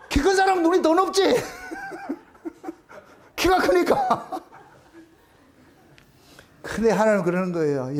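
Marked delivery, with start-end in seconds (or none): 0.1-4.4 high, laughing tease; laughing aside. Real, not acted. Preacher joking with congregation about high standards, laughing. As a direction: crack up while saying it, pitched up.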